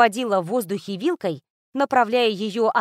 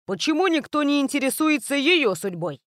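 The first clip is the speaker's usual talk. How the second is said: Grok's voice; 5 semitones higher than usual. A narrator's reading. Grok continues joke.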